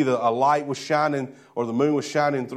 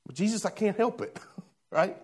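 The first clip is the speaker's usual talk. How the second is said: sheepish excuse; 4 semitones higher, 8 dB quieter than usual. Acted. Acted worrier excusing himself to Jesus.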